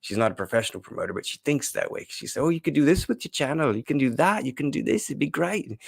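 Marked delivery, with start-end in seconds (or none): 2.4-5.1 imitating her in a failed Australian accent, pitched high; a clumsy Australian accent; bad Australian accent. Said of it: "that was more Australian". 5.1-5.8 mock Australian. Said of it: "that was more Australian".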